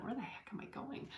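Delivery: baffled whisper to herself; whispered thought. Retold or imitated. Lost on the hill, asking herself in a whisper. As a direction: mutter the doubt under your breath. Retold thought while searching.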